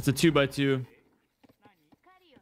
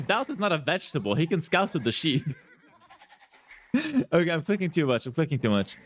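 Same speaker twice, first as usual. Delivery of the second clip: ordinary delivery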